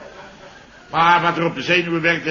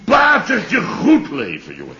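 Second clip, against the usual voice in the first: mock hearty shout; 6 semitones higher than usual. Mocking retold greeting.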